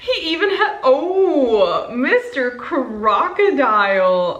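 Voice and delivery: delighted laugh. Real, not acted. Finding another gift item.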